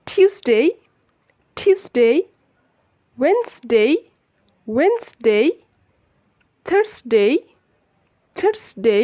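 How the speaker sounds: careful word-list drill from "স্যাটারডে" to "ফ্রাইডে", every "dey" glided, paused between; each day twice from "স্যাটারডে", silent r, two-syllable "ওয়েডনেসডে"; correct pronunciation. Shown as a demonstration. Teacher recapping all seven days, careful model pronunciation. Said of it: "সঠিক উচ্চারণটা খুব সহজেই শিখতে পারেন".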